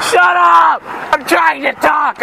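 high yell, then dragged-out complaint; annoyed shout. Real, not acted. Vlogger interrupted by traffic, annoyed shouting. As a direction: shout down the noise.

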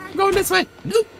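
high frantic call; panicked. Real, not acted. Gamer runs from the killer, high panicked call. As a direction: shout it mid-escape.

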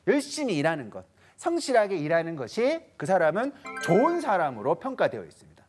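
ordinary delivery

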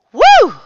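high, loud swooping "woo"; storm whoosh imitation. A narrator's reading. Narrator voicing the storm, high howl. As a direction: howl it out in one burst.

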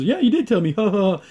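high, hammed-up sing-song reply with fake laugh; playful teasing cartoon voice; mock laughing reply. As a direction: toss it off with a mocking chuckle. Recapping goofy cartoon dialogue.

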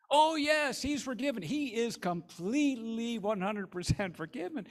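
high, loud, gushing joy; delighted. Acted. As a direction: gush with happiness. Nancy delighted by another check.

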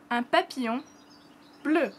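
un papillon bleu said uhn pa-pee-YON bleu; un papillon bleu for learners to repeat; French phrase. Shown as a demonstration. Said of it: "our papillon is bleu".

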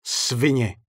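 Svině said firm, no longer whispering; firmer voice. Acted. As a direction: spit the word Svině with new strength. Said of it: "řekl už pevnějším hlasem".

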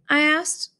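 usual speaking voice

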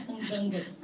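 amused, words breaking into laughter; laughing. Real, not acted. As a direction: talk through a laugh. Laughs at own staff joke.

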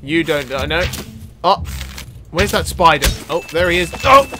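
ordinary talk

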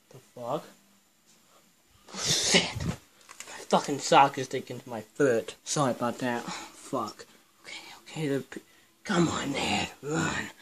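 barely audible, tense hushed swearing; hushed hiding. Real, not acted. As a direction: keep it down so they won't hear you. Hiding from intruders, swearing softly.

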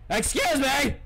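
high, loud indignant excuse me; indignant surprise. Real, not acted. Player startled by the car, indignant outcry. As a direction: call out, offended and startled.

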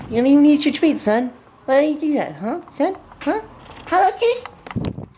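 high-pitched chiding, as to a pet; mock scolding. Owner questions dog about uneaten treats, mock scolding.

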